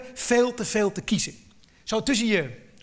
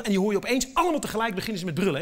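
normal talking voice

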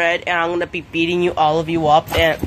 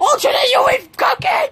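excited cry. Pretend. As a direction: shriek it out in delight at the reunion. Joyful reunion with lost friend.

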